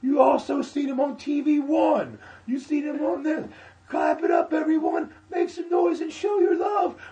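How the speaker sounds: high, loud, slow desperate announcer yell; frantic host hype shout. Retold or imitated. Host who forgot the name, shouting a desperate hype. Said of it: "He goes".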